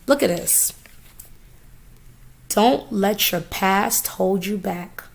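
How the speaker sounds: normal talking voice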